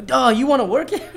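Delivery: high, loud incredulous question; mock astonishment. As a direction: parody a stunned reaction, half laughing. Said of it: "she just started freaking out".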